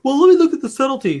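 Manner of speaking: high, fast prissy mockery; mock snooty reviewer. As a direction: sneer it like a pompous critic. Mocking a pretentious reviewer.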